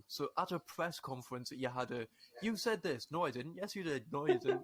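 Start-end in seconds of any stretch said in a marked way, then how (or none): 2.4-4.6 put-on higher-pitched childish back-and-forth bickering; mock squabble. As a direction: imitate a petty yes-you-did, no-I-didn't row. Mimics squabble at press conference.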